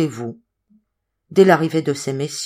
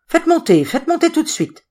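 high, loud urgent command; urgent order; 12 semitones higher, 5 dB louder than usual. A narrator's reading. Coriolis orders the visitor sent up, urgent and high. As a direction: order it at once, pitched right up. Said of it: "ordonna Coriolis".

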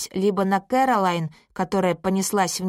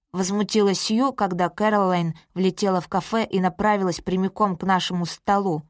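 usual speaking voice

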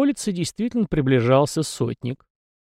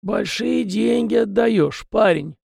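put-on cautious old man; 9 semitones higher, slower than usual. A narrator's reading. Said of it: "осторожно высказался старик".

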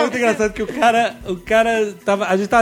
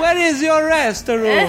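put-on slow, higher Italian-accented English; 5 semitones higher, slower than usual. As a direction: ask like a hammy Italian tourist. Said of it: "inglês com sotaque italiano".

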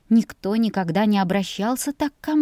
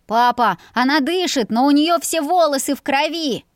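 high, loud excited yell; boy shouting; 7 semitones higher, 4 dB louder than usual. A narrator's reading. Boy shouting to his father about the blood, high loud yell. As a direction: shout the news to dad. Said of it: "Он закричал так громко".

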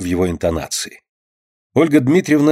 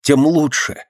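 glad repeat, a little higher; pleased, pitched up echo; joyful exclamation; 5 semitones higher, slower than usual. A narrator's reading. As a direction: echo the joy once more. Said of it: "воскликнул Рубцов радостно".

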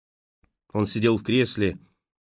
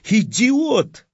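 high, loud angry yell; furious shout; 10 semitones higher, 4 dB louder than usual. A narrator's reading. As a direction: scream the insult at him. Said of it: "закричала я".

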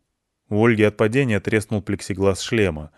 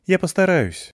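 put-on young woman voice; 10 semitones higher than usual. Olga promising.

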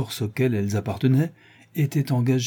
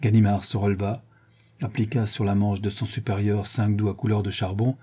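plain reading voice

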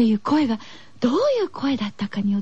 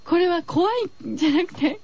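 usual speaking voice

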